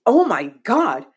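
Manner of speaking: retold astonishment, loud, high and drawn out; amazed. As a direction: exclaim it slowly in mock-fresh amazement. Retold amazed recognition of a stranger.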